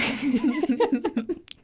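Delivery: a laugh falling away; real laugh in the middle of the story. Real, not acted. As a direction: let a laugh tumble down. Laughing while telling story.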